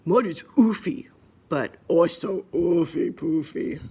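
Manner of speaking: lightly voiced brother piggy; a hint of a pig brother, drawn out at the end. Brother piggy describing the mud, lightly voiced character. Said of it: "Said the brother".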